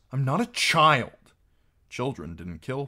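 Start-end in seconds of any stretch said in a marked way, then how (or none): 0.1-1.3 put-on higher, louder childish insistence; indignant protest. A narrator's reading. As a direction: insist on it forcefully, offended. Arya objects to being belittled.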